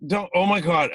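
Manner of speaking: high, loud mock dismay; horrified reaction. Casting director reacting to the actor's emotional prep, horrified.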